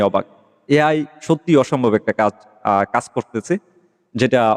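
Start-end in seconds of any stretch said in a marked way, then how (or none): none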